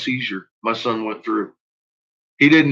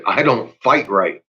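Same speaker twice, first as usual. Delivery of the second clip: ordinary talk, nothing marked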